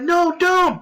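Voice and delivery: very high, acted-out cry to stop; mock panicked plea. Player begs the shooter to stop, mock panicked high plea. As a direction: imitate a desperate last-second plea.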